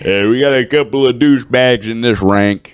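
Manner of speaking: loud barking army sergeant; drill sergeant. Pretend. Mock military sergeant voice.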